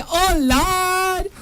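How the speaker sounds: high, drawn-out mock lament; mock pity. Host reads out a listener's teasing comment, mock pity. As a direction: wail it in playful, exaggerated pity.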